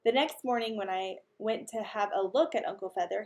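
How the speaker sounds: usual speaking voice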